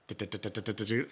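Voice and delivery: soft, even putt-putt noise. Retold or imitated. Rider mimes putting along at walking speed, soft puttering noise. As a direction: softly tick along like a little motor.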